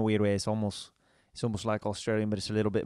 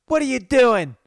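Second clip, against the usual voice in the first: high, loud shocked mother shrieking Joshua's name; mother shouting her son's name in disbelief; shocked mom; 13 semitones higher, 8 dB louder than usual. Retold or imitated. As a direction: do his mom catching him naked, voice way up and loud. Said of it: "And she's like".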